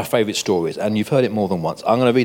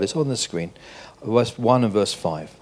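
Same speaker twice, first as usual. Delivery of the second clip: usual speaking voice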